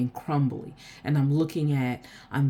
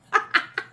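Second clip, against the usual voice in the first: a quick breathy laugh; short laugh. Real, not acted. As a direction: laugh at the coincidence. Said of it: "I'm laughing because".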